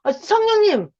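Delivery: high, eager call; calling the Spirit. Shown as a demonstration. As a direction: reach up with the name. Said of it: "이렇게". (높고 간절하게 부르는 "성령님!")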